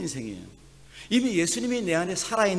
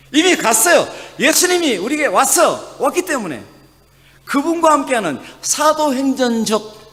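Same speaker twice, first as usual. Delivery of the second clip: higher and louder preaching proclamation; rising pulpit fervor; fervent preaching; 9 semitones higher, 9 dB louder than usual. Acted. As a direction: proclaim it to the congregation. Preaching at full force.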